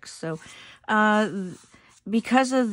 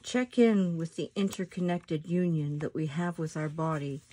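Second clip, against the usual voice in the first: soft, unhurried meditation voice with long pauses; guided meditation; 4 dB quieter than usual. Acted. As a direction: guide them gently into their breath, letting phrases settle softly.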